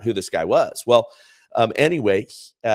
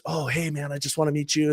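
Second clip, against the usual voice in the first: put-on eager young man; 8 semitones higher, 3 dB quieter, faster than usual. Boyfriend eager, retold.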